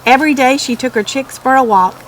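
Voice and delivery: ordinary delivery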